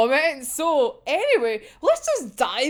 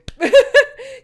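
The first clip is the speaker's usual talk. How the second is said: a loud high burst of laughter; bursting loud giggle after the confession; 11 semitones higher, 10 dB louder than usual. Real, not acted.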